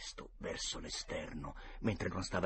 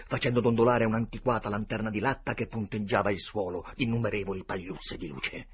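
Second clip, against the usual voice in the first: plain reading voice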